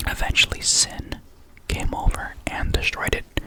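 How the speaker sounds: ordinary talk, nothing marked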